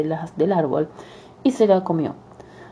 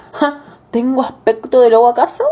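laughing, sly wolf, louder and higher; 5 semitones higher, 4 dB louder than usual. Acted. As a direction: play innocent with a sly laugh. Wolf pretends innocence.

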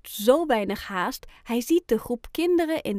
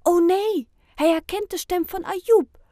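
alarmed narration; 8 semitones higher, 6 dB louder than usual. A narrator's reading. Narrator alarmed at a friend's scream.

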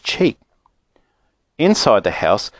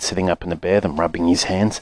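usual speaking voice

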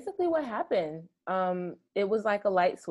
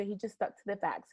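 ordinary talk

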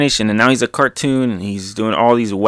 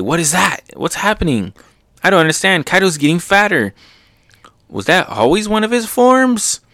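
higher-pitched puzzled voice, climbing; bewildered onlookers; 8 semitones higher than usual. Acted. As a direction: voice cartoon bystanders growing baffled.

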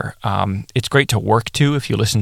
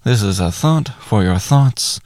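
ordinary talk, nothing marked

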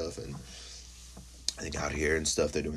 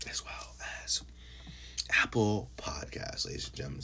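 sudden mock whisper of the plug; 3 semitones higher, 8 dB quieter than usual. Host whispering the podcast list to listeners. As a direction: drop to a secret hush.

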